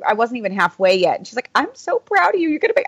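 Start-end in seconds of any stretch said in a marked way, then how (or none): 1.5-2.9 high, gushing imitation of welling up; imitated near-tears. Friend tells runner she is proud, imitated near-tears. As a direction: mimic someone about to cry with pride. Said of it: "Lori almost cried".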